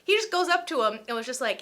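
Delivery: usual speaking voice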